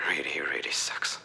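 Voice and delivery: whispered, dejected admission; slipping into a defeated whisper; hushed sorrow. Acted. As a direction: let it sink into a whisper. Grieving never seeing deer again.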